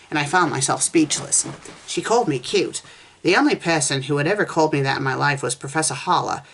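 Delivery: plain reading voice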